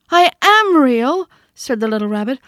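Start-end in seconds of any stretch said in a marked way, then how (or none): 0.1-1.3 much higher, louder and slow tearful protest; near tears. A narrator's reading. Toy rabbit insisting he is real to the wild rabbit, near tears. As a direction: fight back tears and insist. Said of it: "And he nearly began to cry".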